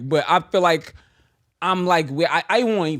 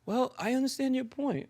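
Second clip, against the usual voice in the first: very soft, measured reply, pitched up; forced calm; 4 semitones higher, 10 dB quieter than usual. Retold or imitated. Answering someone who is yelling at you, forced calm. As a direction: imitate staying composed under attack. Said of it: "It's hard to just be like".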